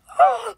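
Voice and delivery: loud high staged gasp; mock shocked gasp. Acting shocked for the gift giver, staged gasp. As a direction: gasp big to show shock. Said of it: "really show her I'm, like, shocked".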